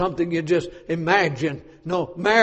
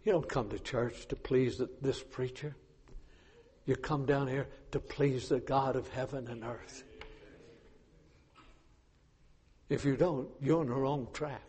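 quiet aside; 5 semitones lower, 13 dB quieter than usual. Real, not acted. Quiet aside after shouting.

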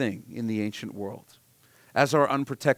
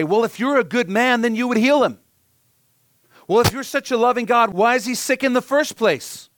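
higher, louder indignant demand; demanding challenge; 10 semitones higher, 7 dB louder than usual. Retold or imitated. Doubters challenging God to heal, higher and louder indignation. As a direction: throw it at God like an accusation. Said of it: "the many who would say".

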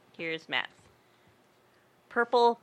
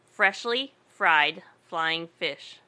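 slow deliberate; much slower than usual. Shown as a demonstration. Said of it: "reading twister slowly".

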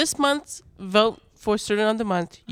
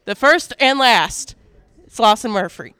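big announcer reveal; 5 dB louder than usual. Acted. Announces the surprise award.

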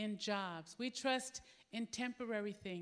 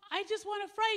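pleading father; 10 semitones higher, 7 dB louder than usual. Retold or imitated.

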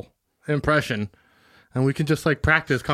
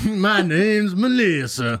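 slow, drawn-out Southern introduction; hammed-up Southern belle; Melissa, Southern accent; 2 semitones higher, much slower than usual. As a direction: stretch it into a put-on Southern drawl. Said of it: "yeah, more Southern".

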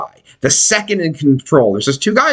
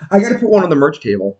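ordinary talk

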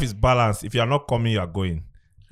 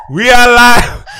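laughing; 7 semitones higher, 6 dB louder, slower than usual. Real, not acted. Laughing shout.

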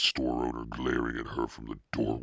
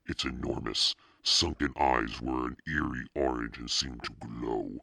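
ordinary delivery

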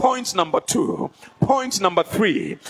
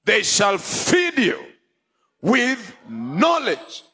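scripture proclaimed; 7 semitones higher, slower than usual. Real, not acted. Preacher to congregation, proclaiming scripture. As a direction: proclaim the verse.

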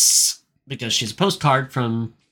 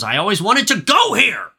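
imitated frustration, pitched up high; frustrated; 8 semitones higher than usual. Podcaster acting out Jacob storming after Queenie, mock-frustrated. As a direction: act out an exasperated complaint, lightly hammed-up. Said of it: "Like, frustrated that he's here".

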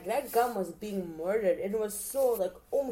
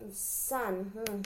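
normal talking voice

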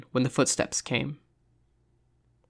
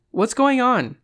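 high, raised call from another tent; pitched-up questioning shout; 10 semitones higher, 4 dB louder, slower than usual. A narrator's reading. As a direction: call over, wanting to know. Calling from another tent, worried.

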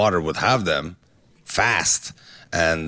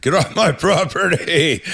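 put-on slow, high, yelling old man; angry old neighbor; 12 semitones higher, 4 dB louder, slower than usual. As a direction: bark it like a grumpy old neighbor. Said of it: "Howie sounds like my seventy-four-year-old neighbor".

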